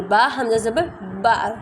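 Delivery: "با حمزہ زبر با" spelled out slowly, letter by letter; slow spelling out. Shown as a demonstration. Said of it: "اسے کیسے پڑھنا ہے آپ نے".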